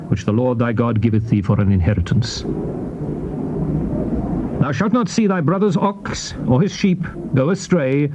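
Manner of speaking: ordinary talk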